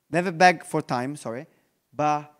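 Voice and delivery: usual speaking voice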